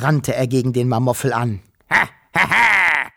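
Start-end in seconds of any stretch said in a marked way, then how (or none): 1.9-3.1 etwas höheres, lauteres Hohnlachen; höhnisches Lachen. A narrator's reading. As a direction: lach den Kleinen höhnisch aus. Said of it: "lachte der". (höhnisches Monsterlachen)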